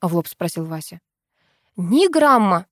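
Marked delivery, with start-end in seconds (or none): 1.8-2.7 higher, louder firm denial; assuring. A narrator's reading. Viola assuring Vasya she doesn't drink, emphatic. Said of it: "заверила его я".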